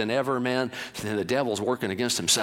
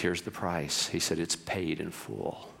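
low, soft, slow paid in full; quiet resolve; 8 semitones lower, 9 dB quieter, slower than usual. A narrator's reading. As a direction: say it gently but final.

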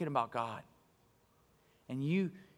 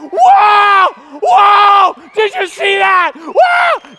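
very high, full-volume screaming; frenzied fan shouting; 20 dB louder, much slower than usual. Retold or imitated. Fan watching the Raiders win, frenzied shouting. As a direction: scream like your team just scored. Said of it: "when they're watching the Raiders win".

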